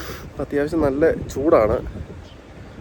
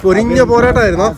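calling out loudly; 8 semitones higher, 6 dB louder than usual. Real, not acted. Calls out to returning child. (ഉറക്കെ വിളിച്ചു ചോദിക്കുന്നു)

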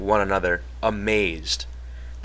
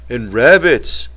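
eager; 2 semitones higher, 5 dB louder, slower than usual. A narrator's reading. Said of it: "Lenny said eagerly".

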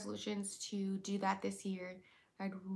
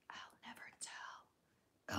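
very slow, breathy secret-keeping whisper; a slow coy whisper, teasing the viewer; mysterious whisper; 10 dB quieter, much slower than usual. Pretend. Coy answer to fake-or-real question.

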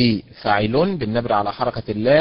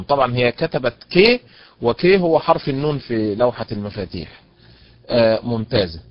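usual speaking voice

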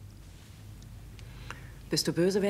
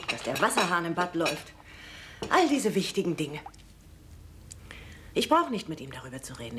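ordinary talk

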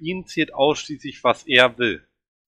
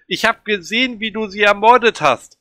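high, loud, frantic yelling; accusing scream; 10 semitones higher, 8 dB louder than usual. A narrator's reading. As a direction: hurl the accusation at him at full voice. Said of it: "und schreie".

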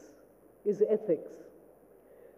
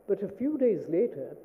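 usual speaking voice

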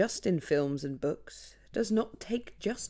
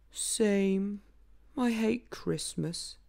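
slow, weary sigh of a donkey; 4 semitones higher, much slower than usual. Acted. Little donkey agreeing with the reindeer, slow and sighing. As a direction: let it out on a sigh. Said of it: "said little donkey with a sigh".

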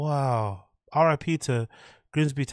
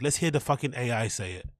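normal talking voice

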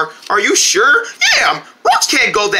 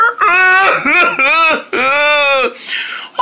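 puppet sobbing; 4 semitones higher than usual. Acted. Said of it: "Oh, don't cry".

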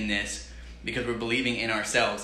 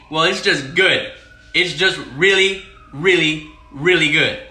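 emphatic rave; 3 semitones higher, 7 dB louder, slower than usual. Real, not acted. Raving about spicy corn.

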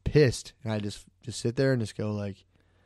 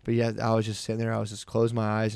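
ordinary talk

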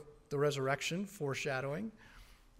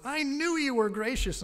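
indignant Jonah; 9 semitones higher, 5 dB louder than usual. Acted. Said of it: "part of what Jonah said was".

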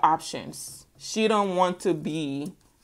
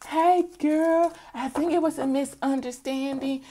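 a hint of a sugary two-faced friend, higher; fake-friendly girl; 6 semitones higher, 4 dB quieter than usual. Mocking a fake friend sliding back in, sugary. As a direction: play it sweet and fake. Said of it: "be like".

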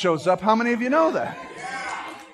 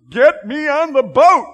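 higher, louder urgent plea; overwhelmed Jesus; 4 semitones higher, 6 dB louder than usual. Pretend. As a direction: call out as if being crushed. Jesus voiced, overwhelmed by crowd.

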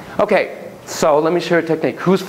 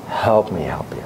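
breathy whispered plea; 8 semitones lower than usual. Acted. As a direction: whisper it, pleading with yourself. Pleading inner voice, whispered.

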